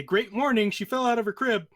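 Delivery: high, hammed-up good news; mock cheerful announcement. Mocks the implausible cheery text.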